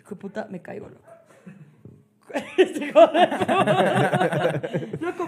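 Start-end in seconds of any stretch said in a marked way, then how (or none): 2.3-5.3 words stretched out through laughter; laughing. Real, not acted. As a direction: laugh helplessly while saying it. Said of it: "nadie se estaba riendo". (entre risas, alargando las palabras)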